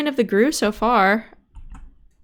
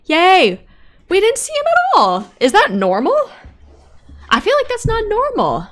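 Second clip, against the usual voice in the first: excited; 11 semitones higher, 5 dB louder than usual. Real, not acted. Cheering after crossing safely.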